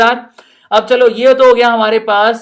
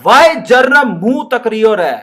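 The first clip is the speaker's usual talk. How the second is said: a light Rajasthani lilt, slow and louder; Rajasthani teacher; 3 semitones higher, 5 dB louder, slower than usual. Acted. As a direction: scold like a village teacher from Rajasthan. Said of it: "it's a Rajasthani". (हल्के राजस्थानी लहजे में डांटती madam)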